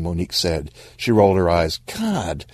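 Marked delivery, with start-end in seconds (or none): 1.9-2.5 high-pitched eye-rolling exasperation; exasperated. Acted. Monique chides whining Stan, exasperated. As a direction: huff it high, fed up with the fuss.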